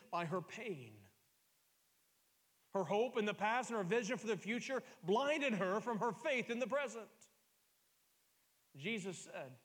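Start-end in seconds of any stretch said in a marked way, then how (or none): 2.7-7.1 loud, high pulpit proclamation; fervent raised preaching; preaching climax. Real, not acted. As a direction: build it up and drive it home. Preacher hammering the point.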